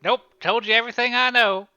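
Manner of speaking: high, loud character voice of denial; lying witness. Acted. Cornered witness lies to the detective, high and loud. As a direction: shut down the questioning loudly and high.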